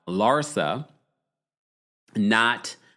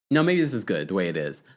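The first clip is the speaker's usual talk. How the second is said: normal talking voice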